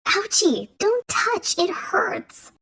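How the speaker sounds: put-on high, whiny cry of a hurt child; child shouting. A narrator's reading. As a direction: wail it like a scared little girl. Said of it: "She started shouting again".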